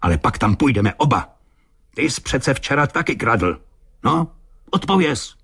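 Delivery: angry retort climbing high in pitch; furious. A narrator's reading. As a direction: press him angrily for an answer. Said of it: "zvolal zuřivě". (zuřivé dorážení, stále výš)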